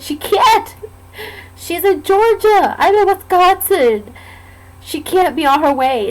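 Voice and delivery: high, insistent retold objection; distressed protest. Injured patient correcting staff about mom, distressed. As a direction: protest with the voice lifted high, stretching the words.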